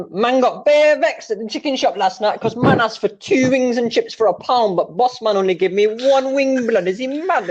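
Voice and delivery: high, put-on London roadman voice; hammed-up street slang rant, drawn out; roadman impression. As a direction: complain like a vexed roadman at the chicken shop. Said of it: "He's like".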